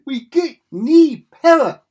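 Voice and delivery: very loud, high, stretched-out mock Scottish; exaggerated Scottish accent at full shout. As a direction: bellow it slowly as a hammed-up Scottish engineer. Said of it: "do my best Scottish accent".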